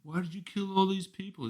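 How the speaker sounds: put-on high, soft child's question; mock child voice. Imitating the child asking about the dead.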